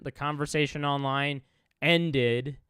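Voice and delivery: ordinary talk, nothing marked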